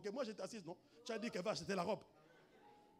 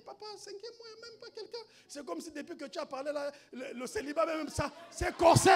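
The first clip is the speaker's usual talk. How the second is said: high mock-plaintive voice, getting louder; plaintive woman, imitated; 9 semitones higher, 12 dB louder than usual. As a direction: imitate a woman complaining to her pastor. Voix de la femme qui se plaint. (imitation d'une femme qui se plaint)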